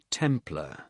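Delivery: usual speaking voice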